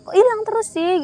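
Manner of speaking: high, frantic mock worry; a worried parent's cry; panicked question.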